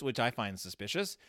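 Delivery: ordinary talk, nothing marked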